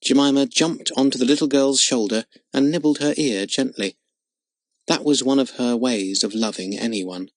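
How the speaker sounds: usual speaking voice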